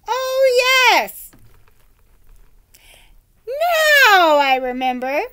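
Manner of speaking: high, loud fake recall; hammed-up old woman remembering; pretending to remember.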